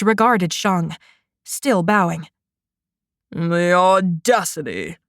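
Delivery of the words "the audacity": put-on slow, contemptuous old judge; spat out. A narrator's reading. Outraged judge scolds Shang, slow contemptuous spit. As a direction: spit the words at him in scorn. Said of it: "the judge spat".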